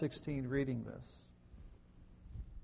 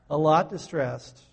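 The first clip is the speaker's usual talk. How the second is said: slow, loud, leaning on lot; a lot distressed; 9 dB louder, slower than usual. Shown as a demonstration. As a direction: really lean into lot. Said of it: "how distressed?".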